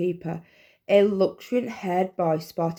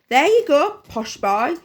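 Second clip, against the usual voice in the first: mum voice; 9 semitones higher, 6 dB louder than usual. A narrator's reading. Mum handing tissue.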